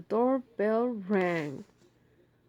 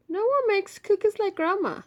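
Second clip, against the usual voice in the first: Ma's voice; 11 semitones higher, 7 dB louder than usual. Acted. Ma voiced in story.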